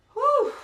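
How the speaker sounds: high loud puffing exhale; breathless whew. Real, not acted. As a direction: blow out hard after sprint. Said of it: "why am I so out of breath".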